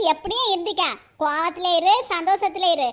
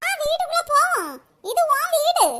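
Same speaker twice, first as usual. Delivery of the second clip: sugary coaxing; 5 semitones higher than usual. Acted. Mother coaxes daughter, fake affection.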